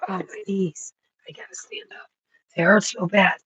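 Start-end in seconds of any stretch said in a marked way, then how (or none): 0.1-2.1 breathy pained whisper about sore knees. Real, not acted. Knees aching while squatting, a pained whisper. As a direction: whisper through the ache.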